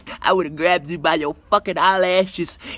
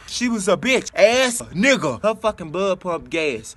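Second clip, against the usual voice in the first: ordinary delivery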